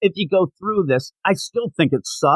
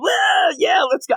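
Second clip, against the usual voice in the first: mock crowd cheer. Thugs cheering the rally speech, mock shouted cheer. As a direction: whoop it up like a rowdy crowd of thugs. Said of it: "And they all yell".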